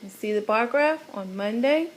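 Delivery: ordinary delivery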